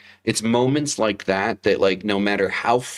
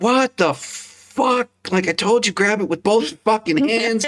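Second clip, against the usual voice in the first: high, strained, exasperated cry at the son; exasperated outburst; 14 semitones higher than usual. Retold or imitated. Dad yelling at son who broke a glass, high exasperated cry. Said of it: "And I'm like".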